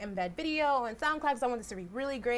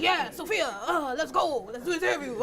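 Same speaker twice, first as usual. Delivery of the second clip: laughing through a hyped-up rapper voice, raised and louder; 5 semitones higher, 7 dB louder than usual. Retold or imitated. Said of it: "he really talks like that in real life".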